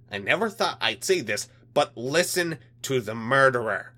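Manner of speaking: a hint of a menacing alien; menacing alien official. Alien liaison mocks.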